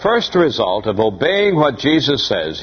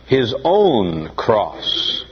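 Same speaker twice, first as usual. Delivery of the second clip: "his own cross." drawn out slowly, leaning on "own"; slow down and press "own" in "his own cross."; stress on own; much slower than usual. Shown as a demonstration. Preacher to congregation, slowly stressing "own" in "his own cross.". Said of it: "the emphasis there is upon the words".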